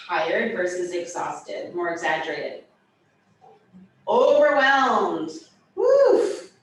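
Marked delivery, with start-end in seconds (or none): 4.1-5.3 dramatic overwhelmed. Shown as a demonstration. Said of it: "right over my head". 5.7-6.5 whoosh sound. Shown as a demonstration. Teacher acting overwhelmed.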